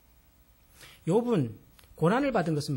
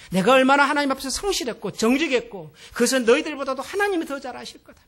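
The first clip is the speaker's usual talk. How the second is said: indignant retort; 2 semitones higher, 8 dB louder than usual. Retold or imitated. Job hotly answering his friends who blame him, rising indignant retort. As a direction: fire back at the accusers, building in heat. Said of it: "막 그러면서 친구들하고 계속 논쟁을 합니다".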